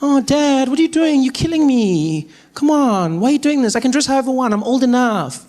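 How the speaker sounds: high whining protest; whiny teen daughter. Retold or imitated. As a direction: plead like a sulky teen.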